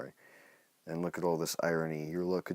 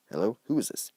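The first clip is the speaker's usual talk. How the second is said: lightly voiced confused parent; 5 semitones higher, 6 dB louder than usual. Voicing the parent on phone.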